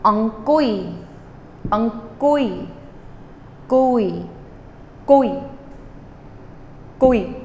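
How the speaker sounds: ong kui run together quickly, kui falling at the end; fast ong kui. Shown as a demonstration. Teacher shows students how to say it fast, quick run-together syllables. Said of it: "you want to work at get it fast".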